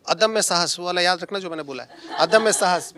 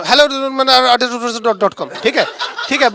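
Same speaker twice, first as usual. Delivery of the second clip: rushed greeting; 3 semitones higher, 3 dB louder, much slower than usual. Retold or imitated. Imitating colleague's rushed greeting.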